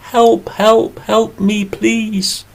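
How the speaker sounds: high, small pleading bee voice; bee crying for help. Acted. Trapped bee under the glass begging for help, high and pleading. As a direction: squeak out a desperate plea from under the glass. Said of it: "and the bee cried".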